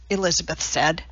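ordinary delivery, nothing marked

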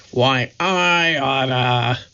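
slow, gruff tough-guy growl. Acted. Moe about to slap Curly in a slow mock growl. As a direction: threaten like a hot-headed gangster. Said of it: "Moe growled".

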